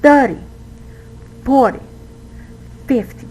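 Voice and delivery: THIRty, FORty, FIFty, high first syllable, weak -ty; stress on first syllable. Shown as a demonstration. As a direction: punch the start of thirty, forty, fifty and drop the ending. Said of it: "as strongly as the first syllable".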